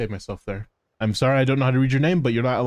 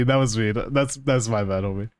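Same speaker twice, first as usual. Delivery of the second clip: normal talking voice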